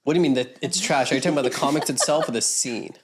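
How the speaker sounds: ordinary delivery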